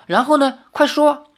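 high, eager prodding; pressing for the rest of the tale; impatient urging. A narrator's reading. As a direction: hurry the storyteller along. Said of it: "林月如催道".

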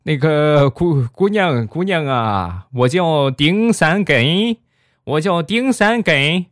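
put-on high, slow, faltering old patient; trembling old man. Old patient timidly addressing the fierce nurse, quavering elder. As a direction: play a timid, doddery grandpa. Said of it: "颤颤巍巍地坐起来".

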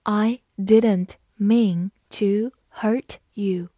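slow, deliberate read-aloud; slow classroom model reading. Shown as a demonstration. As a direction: stretch each word out for learners. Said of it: "好，慢读一遍。".

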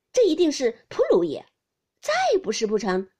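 loud, emphatic guess; eager, hasty guess. A narrator's reading. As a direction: blurt it out with loud certainty. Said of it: "湘云忙道". (急忙抢答，大声肯定)

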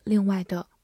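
ordinary delivery

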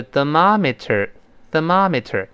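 "thermometer" slowly, with a crisp T in "meter"; slow reading. Shown as a demonstration. Teacher shows the slow version for repeating, slow reading. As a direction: sound out "thermometer" slowly for followers. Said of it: "那么读慢了呢".

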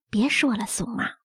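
soft, low, breathy plea to stop; hushed plea. A narrator's reading. Shaken mother stops the nanny's tale, hushed and low. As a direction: hush her, almost whispering.

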